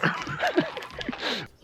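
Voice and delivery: high burst of laughter. Real, not acted.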